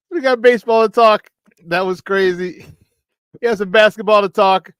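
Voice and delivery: host amped about the lineup; excited rundown. Real, not acted. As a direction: fire up the audience. Hyping show topics.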